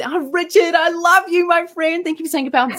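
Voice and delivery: high, bubbly burst of joy; giddy excited greeting; joyful gushing. Retold or imitated. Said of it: "kapow was an expression of joy".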